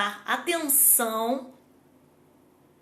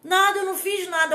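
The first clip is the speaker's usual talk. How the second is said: put-on insistent child; 6 semitones higher, 4 dB louder, faster than usual.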